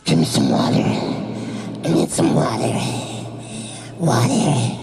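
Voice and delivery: very low, slow rasping plea; parched gasp. Retold or imitated. Collapsed mom begging her son for water, rasping gasp. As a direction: croak it out, dying of thirst.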